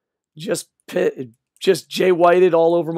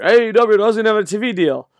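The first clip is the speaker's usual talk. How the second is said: high, loud mocking fan voice; mocking attacking fans; 6 semitones higher, 6 dB louder than usual. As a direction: parrot the fans' attack, pitched up. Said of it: "they just attack A E W".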